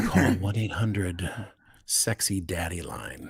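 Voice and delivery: slow, low, soft seductive voice; sexy voice. Pretend. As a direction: purr it like a hotline ad. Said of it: "sounds sexier than it usually does".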